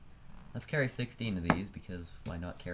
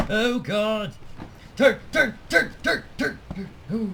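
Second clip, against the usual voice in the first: panicked shout; 12 semitones higher, 9 dB louder than usual. Real, not acted. Plane about to crash, panicking.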